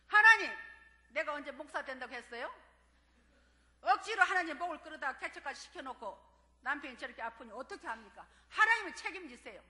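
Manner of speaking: a loud, tearful protest hurled at God; shouting at God. Retold or imitated. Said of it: "소리소리 울면서 ... 소리를 질렀어요". (하나님께 울며 소리치듯)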